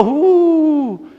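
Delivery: high, drawn-out "uuh"; sustained high wind noise; imitated howling wind. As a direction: whoosh like a gale on the sea.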